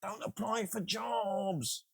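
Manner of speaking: high, slow mock-earnest plea; mocking quote. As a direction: drag it out like a know-it-all. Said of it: "and they went".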